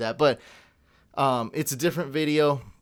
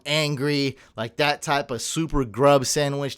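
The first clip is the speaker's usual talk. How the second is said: ordinary talk, nothing marked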